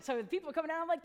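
ordinary talk, nothing marked

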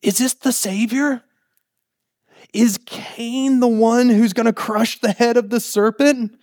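high, wondering questions; hopeful. Acted. Imagined Eve hoping her son is the Savior, hopeful. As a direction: ask with rising hope and wonder. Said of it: "almost this hopefulness of".

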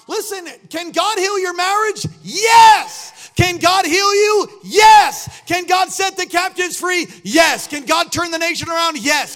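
loud, high call and response; fiery preacher rallying the church; preaching at full force. Acted. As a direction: shout each question and answer yes.

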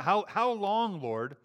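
a prophet pleading with God for help; calling out. Retold or imitated. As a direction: drag out How, how long as a desperate cry. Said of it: "this is Habakkuk calling out".

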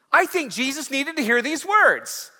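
high and loud, ringing out; full-voiced preacher's declaration; preached refrain. Shown as a demonstration. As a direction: cry it out to the congregation. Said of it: "same refrain, now preached".

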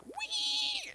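very high, sustained squealing "wee"; thin, piercing mock squeal held on one note; demonic pig squeal. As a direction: imitate a car squealing like a pig. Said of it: "sound like a demonic pig squealing".

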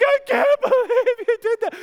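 high, loud words breaking into laughter; laughing disbelief. Retold or imitated. Mom quoted laughing.

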